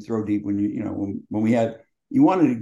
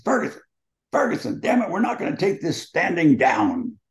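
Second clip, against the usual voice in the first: much higher mock yell at a player; hammed-up sideline rant; yelling coach; 8 semitones higher than usual. Coach yells at his big tackle coming off the field, pitched way up. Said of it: "yelling at him".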